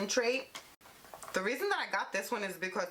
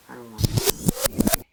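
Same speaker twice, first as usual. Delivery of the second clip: "I don't want to" wailed much louder and slow; whiny wail; 6 semitones lower, 12 dB louder, slower than usual. Pretend. Putting stuff away, loud slow mock whine. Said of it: "I gotta put all this stuff away".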